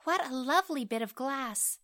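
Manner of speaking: put-on high, delighted child voice; little girl's cry. A narrator's reading. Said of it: "cried the little girl".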